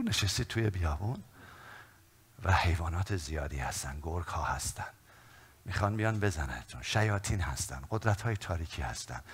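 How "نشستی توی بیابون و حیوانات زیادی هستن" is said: usual speaking voice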